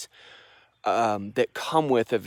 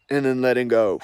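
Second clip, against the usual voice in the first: louder cue on a full breath, falling at the end; breath demonstration; 2 semitones higher, 5 dB louder than usual. Shown as a demonstration. Teacher demonstrating the breath, louder falling cue to let go. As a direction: cue the release from full lungs. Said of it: "I'm just doing that for demonstration".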